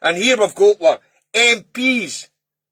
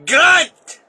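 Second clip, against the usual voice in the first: angry shout; 7 semitones higher, 4 dB louder than usual. Real, not acted. Ranting at MPs in a video, an angry shout. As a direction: bark the word out in real fury. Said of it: "and I am angry here".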